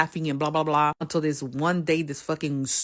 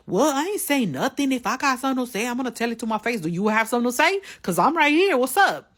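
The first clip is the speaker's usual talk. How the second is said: high, loud, squaring-up challenge; retold defiant comeback; 8 semitones higher, 3 dB louder than usual. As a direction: step up to her, daring her. Rival's retold defiant reply.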